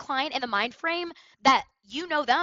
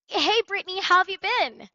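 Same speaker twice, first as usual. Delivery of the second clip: bright, lifted hello, pitched high and louder; upbeat greeting; 8 semitones higher, 7 dB louder than usual. Shown as a demonstration. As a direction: greet the client like you know them. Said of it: "we're here at Tonality".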